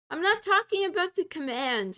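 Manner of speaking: loud, insistent correction; exasperated. Real, not acted. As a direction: stress not, fed up. Arguing with the AI tool.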